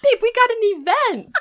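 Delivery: high, loud excited yell; excited shout. Real, not acted. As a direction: shout it with glee. Excited mock alarm.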